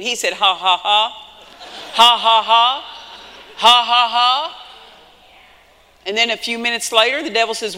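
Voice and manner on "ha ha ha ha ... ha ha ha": loud, forced mock laughter; put-on laugh. Preacher retelling the man laughing at the devil, put-on laugh. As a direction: laugh it deliberately in the devil's face. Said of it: "I put it on".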